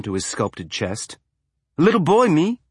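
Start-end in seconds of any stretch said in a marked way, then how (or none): 1.8-2.6 mock little boy. Evans joking.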